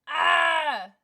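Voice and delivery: sudden loud, high "ah"; short emotional yell; frustrated cry. Real, not acted. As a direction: shout to shake off the tears. Fighting tears, cries out.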